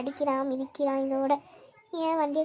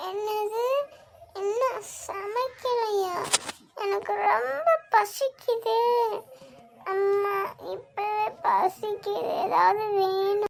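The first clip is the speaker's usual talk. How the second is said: put-on slow whimpering child voice; child fake crying; 3 semitones higher, slower than usual. Son learning nothing is cooked, slow whiny mock crying. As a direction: whimper it out like a starving kid.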